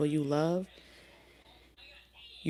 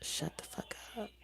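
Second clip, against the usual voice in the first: breathy whispered curse; 5 semitones higher, 14 dB quieter than usual. Retold or imitated. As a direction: hiss it under your breath. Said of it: "You're like".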